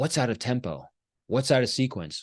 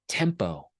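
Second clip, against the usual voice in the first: whispered; 5 semitones lower, 3 dB quieter than usual. Real, not acted. Emphatic key word.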